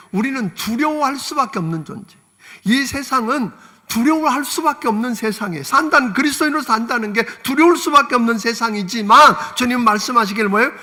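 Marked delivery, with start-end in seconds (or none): none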